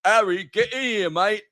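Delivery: high, hammed-up British bloke calling a mate; mock chummy shout from the next room, British; fat middle-aged Ron Weasley. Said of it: "imagine, like, fat middle-aged Ron Weasley".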